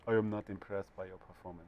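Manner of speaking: put-on a weak French accent that misses and sounds only generally European; failed French accent. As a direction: mumble it in a half-hearted French accent. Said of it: "I sound like I'm European".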